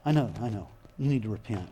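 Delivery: a hint of a weary scolding father; scolding God. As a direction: play the nagging judge people imagine. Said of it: "most people expect God ... to say".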